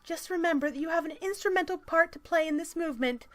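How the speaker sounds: high, loud mock-activist reading voice; strident article read. Reading a feminist article aloud to mock it, strident.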